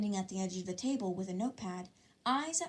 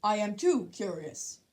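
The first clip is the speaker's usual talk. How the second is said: put-on slower, louder stiff formal boy; a hint of a stiff earnest exclaim; 5 dB louder than usual. A narrator's reading. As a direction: announce it like a rule-bound class rep. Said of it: "exclaimed Ida".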